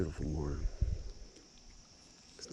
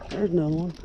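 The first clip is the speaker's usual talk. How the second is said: high, loud excited call; 10 semitones higher, 8 dB louder than usual. Real, not acted. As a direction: call it out, thrilled. Calls out the fish again.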